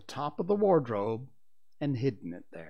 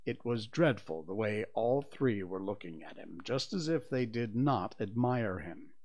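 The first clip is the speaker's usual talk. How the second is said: ordinary delivery, nothing marked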